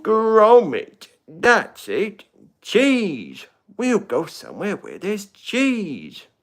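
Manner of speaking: a Wallace voice calling to his dog; Wallace impression. Shown as a demonstration. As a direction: chirp it out like an eager inventor. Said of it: "Wallace and Gromit".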